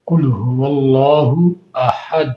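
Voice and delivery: slow, measured tajweed chant of "قل هو اللہ احد"; قرآنی تلاوت کا انداز; Quran recitation. Shown as a demonstration. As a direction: recite the verse slowly, each sound drawn out. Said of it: "علیحدہ علیحدہ تلفظ آپ نے ایک ہی آیت کے اندر ادا کرنا ہے".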